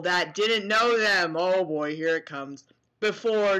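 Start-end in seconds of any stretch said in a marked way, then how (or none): none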